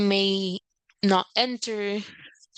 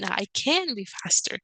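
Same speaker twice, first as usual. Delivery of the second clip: "can" raised high in pitch; stress on can. Shown as a demonstration. Refuting the claim about being faster, stressing "can". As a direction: put the weight on "can", higher. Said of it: "higher and put more stress in that word".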